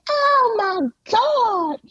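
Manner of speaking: very high, slow, drawn-out gasping oh my God; shocked crowd. Retold or imitated. Studio audience seeing Dwayne arrive, very high shocked wail. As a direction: mimic a scandalized audience. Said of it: "the people are like".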